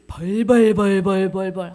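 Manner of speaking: loud, drawn-out mock trembling. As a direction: stretch out the quivering sound in pretend fear. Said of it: "사단이 벌벌벌벌 떠는 거예요". (벌벌 떠는 흉내)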